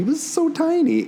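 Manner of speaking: high doting coo. Real, not acted. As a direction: coo it like over a newborn. Cooing over tiny baby.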